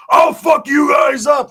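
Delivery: mock shout, stretching the words out; retold angry threat. Roach threatens the guys, angry retold yell.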